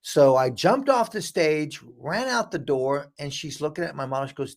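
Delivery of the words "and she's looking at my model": ordinary talk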